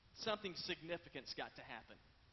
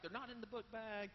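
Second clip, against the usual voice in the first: angry retort; 5 semitones higher than usual. Retold or imitated. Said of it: "I'm angry".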